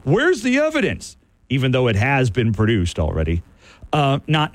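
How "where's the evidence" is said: high mocking fan voice; mocking Michigan fan. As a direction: mimic the fans, pitched way up. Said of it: "When I hear Michigan fans say repeatedly".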